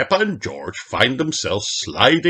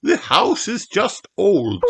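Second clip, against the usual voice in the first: teacher voice; 13 semitones higher than usual. A narrator's reading. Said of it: "says Madame Gazelle".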